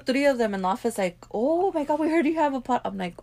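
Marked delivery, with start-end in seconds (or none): 1.3-2.8 high-pitched mock gushing coworker. Host imitates coworkers who found the podcast, gushing. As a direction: imitate coworkers thrilled at their discovery.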